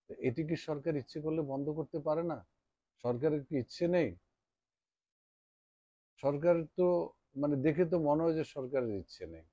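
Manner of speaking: rising indignation at the government; heated rhetorical question. Real, not acted. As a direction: press the question hard, half outraged. Rhetorical complaint about government.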